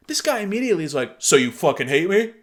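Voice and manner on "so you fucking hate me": louder, put-on indignant snap; mocking the guy's angry reply; mock outburst. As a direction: overblown offended voice.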